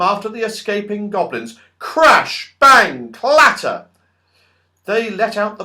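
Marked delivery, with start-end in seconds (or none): 1.8-3.9 loud sound words. A narrator's reading. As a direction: punch each noise word high and loud. Sound effects of thrown pans.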